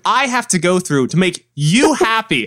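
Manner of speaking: high, drawn-out comic disbelief; exasperated. Retold or imitated. Podcaster rants back at a YouTuber's endless requests, exasperated. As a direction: stretch the key words in incredulous exasperation.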